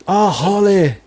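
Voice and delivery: put-on hammy Irish accent again, drawn out; a weak Irish lilt, mocking; bad Irish accent. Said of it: "He's got a really bad Irish accent".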